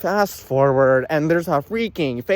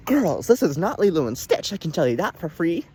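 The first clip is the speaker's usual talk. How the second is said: usual speaking voice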